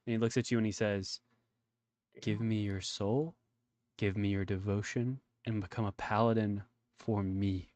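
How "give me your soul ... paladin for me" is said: slow, low villain confession. Acted. Villain explains why he killed the village, slow and low. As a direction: lower and slower, a cold fanatic.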